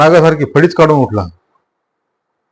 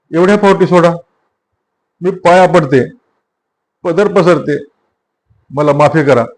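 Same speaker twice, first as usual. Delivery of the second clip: higher pleading woman's voice, lightly voiced; pregnant woman pleading; 4 semitones higher than usual. Beaten pregnant woman begging Mathaji to stop, pleading. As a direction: beg for mercy, voice up high. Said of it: "बाईच्या त्या हंबरड्यानं".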